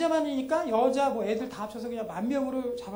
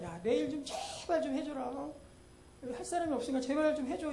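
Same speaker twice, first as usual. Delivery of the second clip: mock begging Jesus; 3 semitones higher, 7 dB quieter than usual. Jesus imagined begging the disciples, soft mock plea. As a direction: plead like someone with nobody else to ask. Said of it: "예수님 우리에게 매달리는 겁니까".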